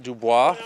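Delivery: Dubois said "doo-BWAH", loud and rising; French-accented pronunciation. Shown as a demonstration. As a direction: give Dubois a French "bwah" ending, punched up. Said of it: "I kinda put a little accent".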